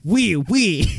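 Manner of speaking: loud comic French chirp; mock French accent. Co-host joins the tooting joke in mock French accent. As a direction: join in with silly French accent.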